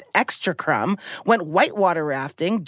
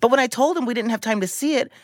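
ordinary talk

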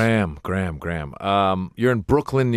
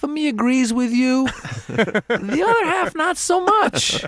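much higher, slowing, sly mock voice; cheeky comeback; 14 semitones higher, slower than usual. [name] answering her coworkers, cheeky mock comeback. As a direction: tease them with a sing-song twist.

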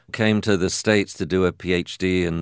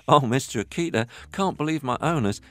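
high, gushing puppy voice; excited puppy voice; 8 semitones higher than usual. Retold or imitated. Puppy telling the Akita about the liver treats, delighted. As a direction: rave like a delighted little puppy. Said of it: "go down the garden to Akita and say".

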